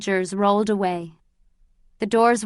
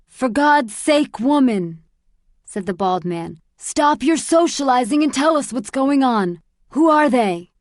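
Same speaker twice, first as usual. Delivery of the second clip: put-on higher, louder impatient old grump; irritated demand; 4 semitones higher, 5 dB louder than usual. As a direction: bark at her to stop chatting. Bald man demands answers.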